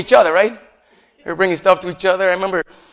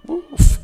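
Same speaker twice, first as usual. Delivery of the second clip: pained oof; 12 semitones higher, 7 dB louder than usual. Real, not acted. Reacting to Peter's denial.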